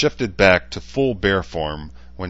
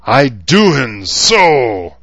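slow, loud bear growl, much higher; growling grizzly; 7 semitones higher, 12 dB louder, much slower than usual. A narrator's reading. Grizzly bear growling at the council table. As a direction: drag each word out like a furious bear. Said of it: "the grizzly growled".

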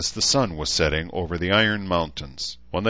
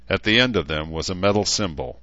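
usual speaking voice

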